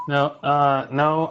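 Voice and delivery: ordinary talk, nothing marked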